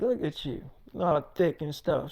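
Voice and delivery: low, quiet sweet talk; soft flirting. Retold or imitated. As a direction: murmur it low as a compliment.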